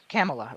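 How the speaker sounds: "Kamala" as KAM-uh-luh; starts like Cam. Shown as a demonstration. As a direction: open "Kamala" with the vowel of "Cam". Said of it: "they think Cam".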